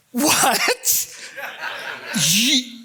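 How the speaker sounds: high, broken, laughing shock; stunned. Retold or imitated. Disciples recognizing Jesus at the bread, stunned. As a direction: stammer in disbelief. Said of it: "they realize".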